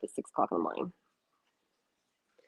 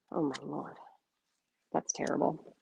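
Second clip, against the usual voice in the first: much softer, a hushed aside; quiet dismay; 2 semitones lower, 10 dB quieter than usual. Real, not acted. Host reacting to a viewer's 5 AM video, hushed dismay. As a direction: mutter it under your breath in mock horror.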